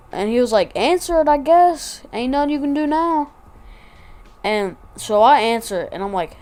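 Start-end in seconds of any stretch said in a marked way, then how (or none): 0.8-3.3 high-pitched put-on voice for a buddy; imitated friend. Friend advising him to answer grandma, high mimicked voice.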